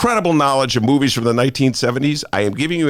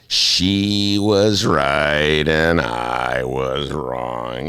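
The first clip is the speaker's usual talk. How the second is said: grudging confession; 9 semitones lower, much slower than usual. Acted. Dramatic admission of defeat.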